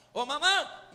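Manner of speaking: high, loud yell for mom; sudden shout. Retold or imitated. Son calling his mother around the house, sudden shout. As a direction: holler it out to startle. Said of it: "grita así".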